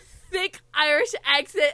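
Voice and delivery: THICK leaned on, loud and slow; stress on thick. Real, not acted. Reader stumbles on Niall's description, "thick" drawn out loud. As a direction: drag out and punch the word "thick". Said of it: "I just can't with the thick".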